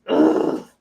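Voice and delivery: low, loud growled ugh; disgusted groan. Real, not acted. Woman venting about the bear, disgusted groan. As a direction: groan it out from the throat in exasperation.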